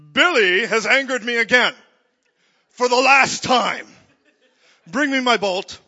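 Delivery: mock Zeus bellowing for his lightning bolt; booming Zeus. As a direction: roar it like an offended Greek god. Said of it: "he's like a Zeus up there".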